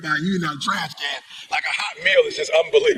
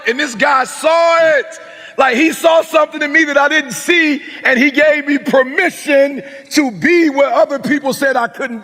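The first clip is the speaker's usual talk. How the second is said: full-force preaching; 7 semitones higher than usual. Acted.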